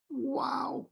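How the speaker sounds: high, soft drawn-out wow; amazed. Real, not acted. Buyer marvels at the unboxed knife, amazed. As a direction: let out an awed, high-pitched wow.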